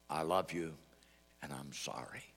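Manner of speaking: tender confession. Retold or imitated. Husband's phone confession, retold.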